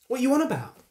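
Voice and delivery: high-pitched, dismissive imitation of the wife; shrugging it off. Wife brushing off her husband's question about the baby, dismissive. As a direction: toss it back high, as if nothing happened. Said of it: "then shrugged it off".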